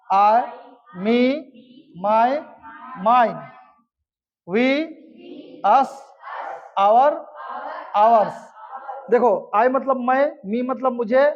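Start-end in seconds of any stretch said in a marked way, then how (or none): 0.1-8.4 one word at a time with pauses; call-and-response drill. Shown as a demonstration. Teacher leading pupils in memorising pronouns, call-and-response drill. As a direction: recite it for a class to repeat. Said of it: "हम याद करते हैं इसको ऐसे, बोलिएगा मेरे साथ".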